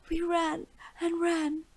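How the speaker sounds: put-on high, teary little girl voice; tearful girl. A narrator's reading. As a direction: sob it out like a scared child. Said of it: "tears streaming down her dirty face".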